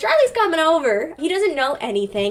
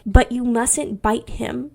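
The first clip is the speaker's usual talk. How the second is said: put-on slow, measured telling-off for a toddler; talking to toddlers; slower than usual. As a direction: spell it out slowly, as to a preschooler. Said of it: "something that I say to toddlers".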